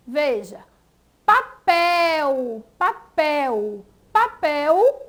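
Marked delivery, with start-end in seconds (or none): 1.3-5.0 "papel" with a strong, held PEL; stress on final syllable. Shown as a demonstration. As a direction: repeat "papel" deliberately, leaning on the last syllable. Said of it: "que é a sílaba mais forte".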